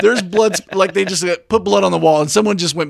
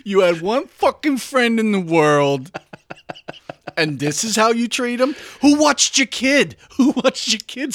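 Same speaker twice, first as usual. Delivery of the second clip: mock yelling; 6 semitones higher than usual. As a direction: build into melodramatic high-pitched accusation. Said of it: "She's yelling at a man who lost his wife".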